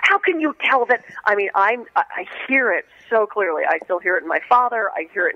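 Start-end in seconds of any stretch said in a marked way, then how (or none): 0.0-1.0 high-pitched mock surprise; astonished reaction. Winemaker amazed at being placed in Pittsburgh, mock astonished. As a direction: imitate someone caught completely off guard.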